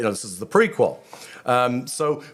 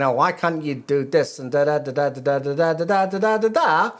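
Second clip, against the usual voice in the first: complaint trailing into rising, sing-song blah-blah; mock complaining; 3 semitones higher than usual. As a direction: parody someone complaining, pitch rising to the end. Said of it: "And she's complaining".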